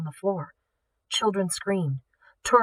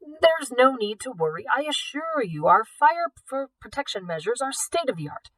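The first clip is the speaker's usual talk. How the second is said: pompous reassurance; 6 semitones higher than usual. A narrator's reading. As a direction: reassure him with pompous confidence. Director reassuring Hale.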